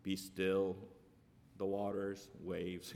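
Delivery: hammed-up soft-spoken holy voice; mock meek Jesus. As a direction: parody a feeble, timid Jesus. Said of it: "Did Jesus always talk like this?".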